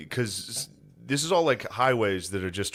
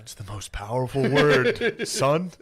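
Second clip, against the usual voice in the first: slow, grave fatherly warning, a little higher; solemn father; 5 semitones higher, 3 dB louder, slower than usual. Retold or imitated. Dad warning his kid about a slur, slow mock solemnity. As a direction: hand down wisdom to a son, hammed up. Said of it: "He's like".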